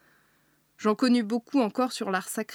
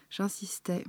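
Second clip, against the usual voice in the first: plain reading voice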